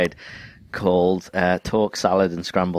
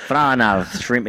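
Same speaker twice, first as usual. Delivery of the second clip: imitated Australian accent; 3 semitones higher than usual. Shown as a demonstration. Showing the co-host how it differs, Australian accent. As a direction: put on a harsher Australian voice. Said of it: "the Australian accent".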